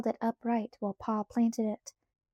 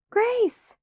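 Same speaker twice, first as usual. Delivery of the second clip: high, loud scream of a name; 12 semitones higher, 5 dB louder than usual. A narrator's reading. Laura sees only empty prairie, screaming for Grace. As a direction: scream for a missing child. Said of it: "she screamed".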